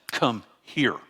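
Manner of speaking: firm command, "Come" and "here" set apart; stern grandparent's summons, no lilt; stern to a grandchild. Shown as a demonstration. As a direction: order a child over, each word falling. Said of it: "me looking at my grandchild and saying".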